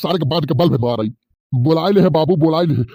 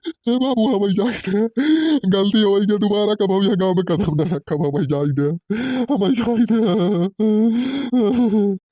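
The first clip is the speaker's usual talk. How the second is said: higher, begging voice, drawn out at the end; raised pleading whine; 5 semitones higher, slower than usual. Acted. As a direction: beg to be let go, stretching the last plea. Boy begging again to be let go.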